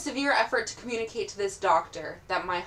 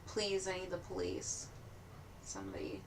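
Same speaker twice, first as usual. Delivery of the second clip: weak plea; 3 semitones lower, 17 dB quieter than usual. Pretend. Player character begs, fading weakly.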